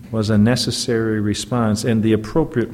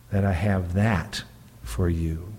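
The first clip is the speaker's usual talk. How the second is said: usual speaking voice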